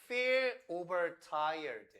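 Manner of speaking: very slow, "feel overtired" drawn out; slow emphasis. Shown as a demonstration. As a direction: lay out "feel overtired" slowly word by word. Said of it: "아까 봤던 거". ("feel overtired"를 아주 천천히 또박또박)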